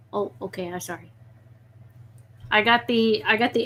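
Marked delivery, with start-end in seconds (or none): 0.1-1.1 very quiet, lower little apology; soft apology. Real, not acted. Owner apologizing to an annoyed pet, soft and low. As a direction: back off gently, hushed and low.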